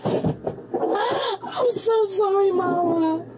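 sobbing shout, drawn out; crying out. Real, not acted. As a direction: wail an apology through tears. Meltdown in police car.

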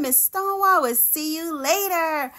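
ordinary delivery, nothing marked

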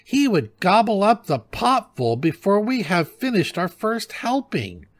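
put-on very high grumbling old-wife voice; high-pitched nagging, held to the end; scolding wife. A narrator's reading. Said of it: "So she began to scold the old man".

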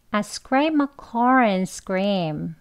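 very slow, connected sentence; slowly. Shown as a demonstration. Teacher slowly reads the linked sentence for the students. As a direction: read the whole line slowly first. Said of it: "천천히 한번 먼저 해볼게요".

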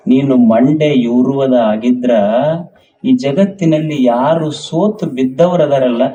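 ordinary talk

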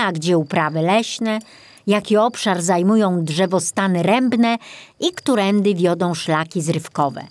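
plain reading voice

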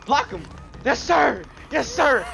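excited cheering. Real, not acted. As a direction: cheer on the block. Cheering in game.

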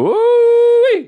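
loud, high ooh-wee; excited whoop. Real, not acted. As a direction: whoop it out, impressed. Hearing Louis showed up.

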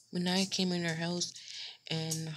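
ordinary talk, nothing marked

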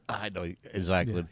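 normal talking voice

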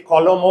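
কলম with an added final vowel, "kaw-lo-mo"; wrong pronunciation demo. Shown as a demonstration. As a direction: sound the last letter of কলম as an open "mo". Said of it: "কলম বলি না".

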